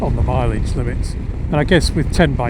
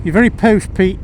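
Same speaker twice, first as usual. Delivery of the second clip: mock-posh ribbing, pitched up and louder; teasing friend; 5 semitones higher, 4 dB louder than usual. Mimicking a friend who teases him about his posh house. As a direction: tease your mate about being posh.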